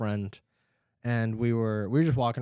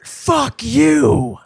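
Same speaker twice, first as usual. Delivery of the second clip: high, loud two-part yell; imitated mom, ten times harder; 11 semitones higher, 8 dB louder, much slower than usual. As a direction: go way over the top like an irate mother. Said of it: "ten times harder and say".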